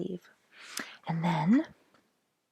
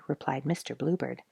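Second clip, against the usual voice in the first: ordinary delivery, nothing marked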